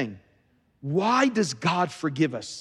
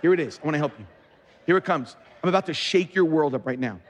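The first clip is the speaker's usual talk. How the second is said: normal talking voice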